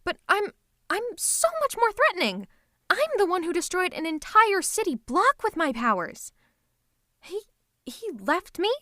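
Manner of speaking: loud, high-pitched villainous outrage; indignant outrage. Acted. Villain ignored for another's call, indignant outrage. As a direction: rant in wounded, jealous disbelief.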